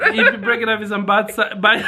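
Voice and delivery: fast, giggling attempt; laughing. Real, not acted. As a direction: stumble through laughing. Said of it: "we all know he means bite-sized pieces".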